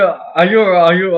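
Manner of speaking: ordinary delivery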